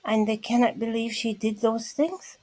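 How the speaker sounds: normal talking voice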